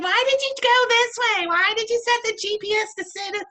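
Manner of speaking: high, loud exasperated wife; a wife demanding why he set the GPS here; wife scolding. Acted. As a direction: snap at the husband who took the wrong route. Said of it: "She's like".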